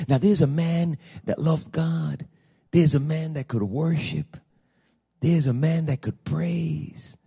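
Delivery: slow and hushed, spaced phrases; soft, solemn reflection. Real, not acted. Preacher reflecting on a man that loved God, soft and solemn. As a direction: dwell softly on each phrase, almost mournfully.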